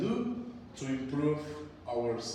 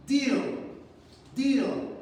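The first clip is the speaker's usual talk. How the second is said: higher and louder, set out as a model word; 7 semitones higher, 4 dB louder than usual. Shown as a demonstration. Teacher modeling the spelling word for students to repeat, raised voice. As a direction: call the word out for the class to echo. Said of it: "Repeat after me".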